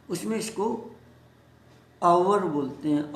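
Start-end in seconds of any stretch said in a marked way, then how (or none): 2.0-2.4 आवर in two syllables, "aa-wur"; British hour. Shown as a demonstration. Teacher shows the British form, आवर as "aa-wur". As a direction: sound the w glide in आवर. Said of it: "British English में".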